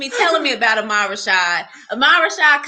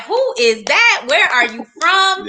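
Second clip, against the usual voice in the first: higher, slow puzzled who is that; surprised curiosity; 6 semitones higher than usual. Retold or imitated. Puzzled by his accent, she asks in higher surprise. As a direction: call out wondering who he is. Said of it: "hearing a different accent".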